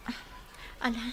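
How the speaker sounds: normal talking voice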